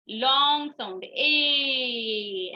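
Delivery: very slow, stretched "ए" as a long vowel; drawn-out teacher demo of long "ए". Shown as a demonstration. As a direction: hold the "ए" long, like showing a child. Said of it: "long ए बोलते हैं".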